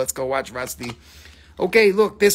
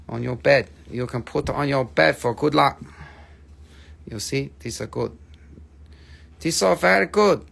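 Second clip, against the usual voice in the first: broken English in a mock foreign accent; hammed-up accented sales patter; put-on foreign accent. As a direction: play a foreign street vendor accent. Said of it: "all the accents".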